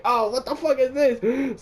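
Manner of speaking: slow, high disbelief; shocked high exclaim. Retold or imitated. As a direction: gasp it out in shock. Retold shocked reaction.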